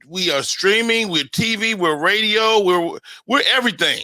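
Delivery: ordinary talk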